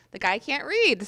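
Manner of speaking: usual speaking voice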